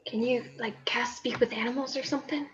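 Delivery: hushed whisper to a friend. Real, not acted. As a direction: breathe it out so nobody hears. Hushed aside beside the rat.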